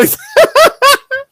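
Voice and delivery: high burst of laughter. Real, not acted. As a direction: crack up loudly. Laugh after quote.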